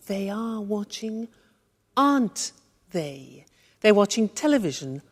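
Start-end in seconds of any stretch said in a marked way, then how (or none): 0.1-3.4 slow, word-by-word question tag; broken-down demonstration. Shown as a demonstration. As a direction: draw out "aren't they" slowly for learners. Teacher breaks the tag down.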